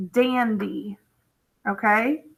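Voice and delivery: normal talking voice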